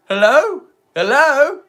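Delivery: loud rising hello; calling out. Real, not acted. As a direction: call out to an empty room. Calling out to chat.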